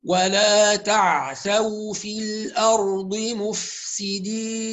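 slow measured tajwid reading; Quranic recitation. Shown as a demonstration. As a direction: recite the verse slowly. Recites verse.

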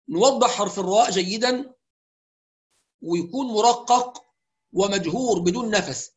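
ordinary talk

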